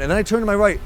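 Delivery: ordinary delivery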